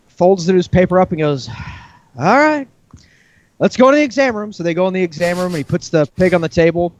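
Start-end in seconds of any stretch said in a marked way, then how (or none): 2.2-4.5 reluctant vet. Acted. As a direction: play a vet grudgingly giving in. Vet grudgingly gives in, acted.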